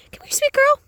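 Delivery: very high sweet voice coaxing an animal; pet talk. Real, not acted. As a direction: coo up high to a shy goat. Calling a goat over.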